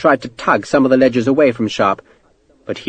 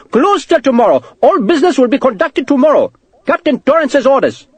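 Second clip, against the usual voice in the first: high-pitched, agitated clerk, only slightly raised volume; shouted; 13 semitones higher than usual. A narrator's reading. Clerk turning Sharp away, shouting. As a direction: cry out high, officious and accented. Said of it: "the clerk shouted".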